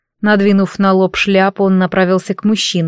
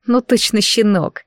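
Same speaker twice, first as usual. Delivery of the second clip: ordinary delivery, nothing marked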